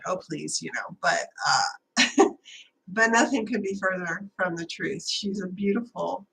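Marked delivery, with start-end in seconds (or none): 0.1-0.6 quiet, softly dismissive retort; mild dismissal. Retold or imitated. Retelling her reply to the frightened kid, low-key dismissal. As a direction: wave it away under your breath.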